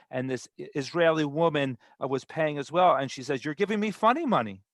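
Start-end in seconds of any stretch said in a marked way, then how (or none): 3.4-4.6 higher-pitched mock objection; retold accusation. Israeli shopper challenges the vendor over her change, retold indignation. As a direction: play a mildly indignant customer.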